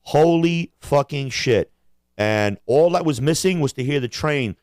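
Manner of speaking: normal talking voice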